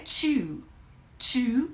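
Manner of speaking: "chew" started with a TCH sound. Shown as a demonstration. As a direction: demonstrate "chew" with a full T-plus-SH onset. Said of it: "tem um som de ch".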